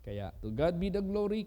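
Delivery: ordinary delivery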